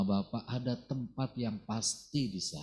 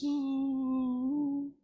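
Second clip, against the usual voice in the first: mock sob; 13 semitones higher than usual. Women weeping on the road.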